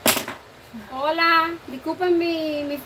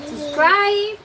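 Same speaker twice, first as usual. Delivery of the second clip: excited shout; 5 semitones higher, 6 dB louder than usual. Real, not acted. Mom jokingly tells viewers to subscribe.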